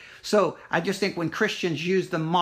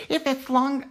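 higher mock complainer; 8 semitones higher than usual. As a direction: start a mocking, higher-pitched whine of a complainer. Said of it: "getting ready to use a mocking voice".

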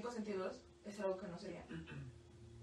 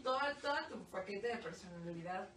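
normal talking voice

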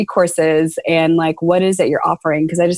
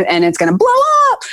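excited; faster than usual. Retold or imitated. Imagined thought of success.

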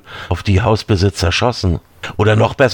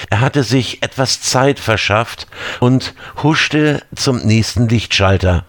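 ordinary delivery, nothing marked

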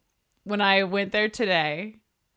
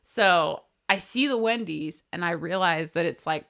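ordinary talk

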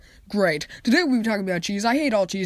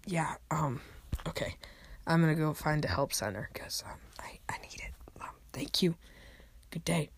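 whispered, much softer sign-off; embarrassed whisper; 4 semitones lower, 13 dB quieter than usual. Acted. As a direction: whisper it, ashamed.

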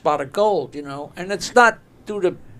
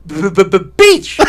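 loud stammer on the B; stuttering; 10 dB louder than usual. Retold or imitated. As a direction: stammer out b-b-b-beach. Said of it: "they're all stuttering".

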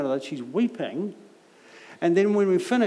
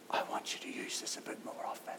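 soft unvoiced whisper, like God's quiet nudge; 16 dB quieter, faster than usual. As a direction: breathe it as a gentle inner prompting. Voicing God's gentle prompting.

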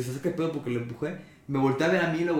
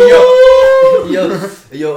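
grito emocionado; 18 dB louder, much slower than usual. Real, not acted. Emoción al contar la pelea.

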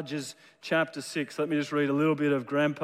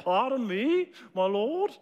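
put-on light, higher English accent, very polite; 4 semitones higher than usual. Preacher voicing Gideon to the congregation, lightly English and polite. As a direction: ask it with teacup-and-pinky English politeness. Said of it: "Very polite".

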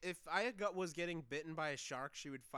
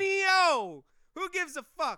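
loud, high shouted Amazonio; mock shout; 10 semitones higher, 11 dB louder than usual. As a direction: blurt Amazonio like a fake accent. Mock accented pronunciation shout.

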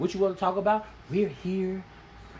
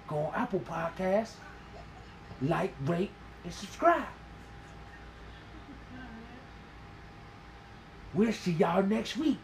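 mock dramatic sign-off, softer; in the brooding actor voice; 8 dB quieter than usual. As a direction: deliver the outro like a brooding TV hero. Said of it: "you want me to tell 'em like this?".

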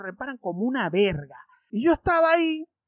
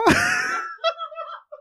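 high laugh. Real, not acted. Laughing at own impression.

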